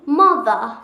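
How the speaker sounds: Mother in a British accent. Shown as a demonstration. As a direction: model Mother clearly for repeating. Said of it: "Say with me".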